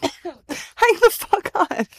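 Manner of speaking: slow, drawn-out disbelief; stunned disbelief. Retold or imitated. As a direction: stretch it out in shock. Disbelief at old footage.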